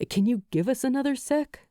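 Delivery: quick, pleading ask; fast and pleading; imploring. A narrator's reading. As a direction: rush the request. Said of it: "Luke implores".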